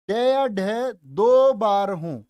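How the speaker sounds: slow, spaced dictation pace; teacher dictating the rule slowly. Shown as a demonstration. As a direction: read each word out slowly for notes. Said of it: "दूसरा नियम".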